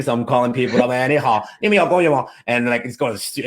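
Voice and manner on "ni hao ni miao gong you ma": Chinese with a lightly voiced Beijing accent; a hint of a Beijing Mandarin accent. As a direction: rattle it off like a Beijing local. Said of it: "hardcore Beijing".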